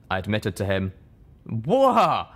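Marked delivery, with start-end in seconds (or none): 1.4-2.3 louder, higher startled cry; startled whoa. Acted. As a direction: caught off guard. Calamity startled.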